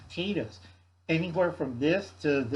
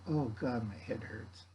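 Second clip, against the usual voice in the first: low, fading "my head hurts"; mock pained groan; 4 semitones lower, 9 dB quieter than usual. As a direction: moan it like a headache. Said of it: "Uncle Alan's head going".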